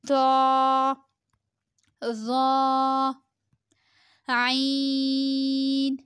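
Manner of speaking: full letter names held on a level tone; drawn-out letters. Shown as a demonstration. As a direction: sustain each rounded vowel evenly. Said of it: "پانچ انگلیوں کے برابر کھینچیں گے".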